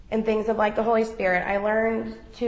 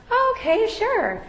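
high, caught-off-guard "oh, okay"; surprised, much higher agreement; startled okay; 12 semitones higher, slower than usual. Retold or imitated. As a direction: sound thrown by the request. Startled reply to Chelsea.

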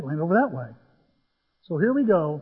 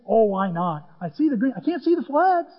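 normal talking voice

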